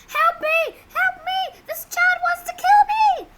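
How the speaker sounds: very high, loud mock cries for help; hammed-up screaming. Storyteller imitating the hotel woman accusing a child, hammed-up scream. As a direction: scream it like an overacted victim. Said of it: "She starts screaming".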